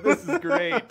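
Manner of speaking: sarcastic laughing; sarcastic delight. Retold or imitated. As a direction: say it with a laugh, tongue in cheek. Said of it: "I was like".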